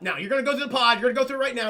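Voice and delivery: retold bossy demand; forceful order. Man dragging her to the pod, forceful mock command.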